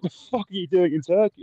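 high, put-on incredulous brother; lightly voiced shocked older brother; mock brother. Brother asking why he was in Turkey, mock incredulous voice.

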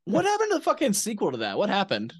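high, fast excited question; excited outburst. Real, not acted. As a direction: blurt it out, worked up. Excited outburst about sequel.

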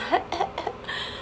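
soft airy laugh at herself; a quick unvoiced chuckle; shy self-amused laugh; breathy giggle. Real, not acted. Laughs at own podcast plug.

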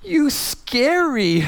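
put-on high, very slow, near tears like a small girl; a child's tearful voice, drawn out; little daughter's voice. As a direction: play the scared little girl on the verge of tears. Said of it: "she said".

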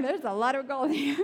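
laughing out loud, close to tears. Real, not acted. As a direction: giggle through the words at the joke. Laughing about the gold card.

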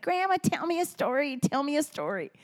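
put-on high, pleading small-child voice; little grandson begging. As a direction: beg like an eager three-year-old. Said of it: "and he's like".

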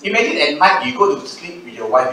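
ordinary talk, nothing marked

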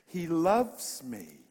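drawn-out wonder; slow, savoured. Real, not acted. Preacher marvels that God loves him. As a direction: let each word linger. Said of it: "slow savouring of words".

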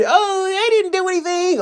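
high, mocking innocent voice; mocking whiny excuse. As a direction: parody the rioters playing innocent. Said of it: "they wanna act all silly".

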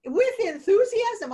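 overjoyed, stretched-out exclamation; gushing enthusiasm. Shown as a demonstration. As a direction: sing it out with huge enthusiasm. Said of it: "I'm the most enthusiastic person around".

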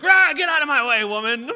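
mock snap, blowing up; flying off the handle. Imitating himself shouting at his wife while stressed. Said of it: "all of a sudden I fly off the handle".

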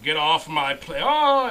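loud slow action-hero line breaking into a high yelp; mock yell into a scream. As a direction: bellow it slowly, then scream as if falling. Imagined Biden yelling, then falling out.

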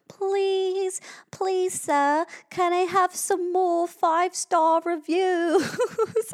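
high, drawn-out hammy British urchin; bad British accent. Podcaster begging listeners for reviews, mock British accent. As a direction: beg pitifully in a badly faked British accent. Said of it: "Those had a really bad accent".

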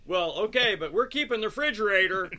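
loud, high, defiant insisting; mock stubborn seller. Seller insisting to buyers, loud and defiant. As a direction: play the seller who won't budge on the fridge.

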